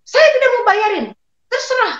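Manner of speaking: high-pitched, indignant mock outburst; retold angry refusal. Storyteller acting out the husband refusing his wife's debt, angry. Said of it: "dia marah-marah".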